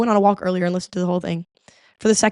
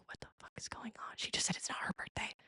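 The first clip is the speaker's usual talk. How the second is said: hushed aside; an incredulous unvoiced whisper to the viewer; 23 dB quieter than usual. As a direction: whisper it behind her back. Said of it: "I look over at her and I'm like".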